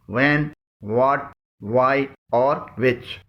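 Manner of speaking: وین، واٹ، وائی، وچ said slowly with silent h; correct pronunciation, slow. Shown as a demonstration. Teacher slowly models the correct question words. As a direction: model each word slowly with no h sound. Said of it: "ان تمام الفاظ کی صحیح pronunciation ہے".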